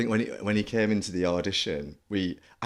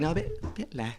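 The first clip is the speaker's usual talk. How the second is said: high, soft little correction; restrained director's note; 8 semitones higher, 6 dB quieter than usual. Retold or imitated. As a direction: gently rein the actor in, softly. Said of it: "they're just like".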